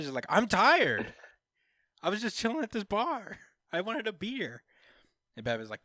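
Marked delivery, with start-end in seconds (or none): 0.3-4.6 tired Irish guy. Retold or imitated.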